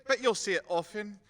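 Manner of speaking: ordinary talk, nothing marked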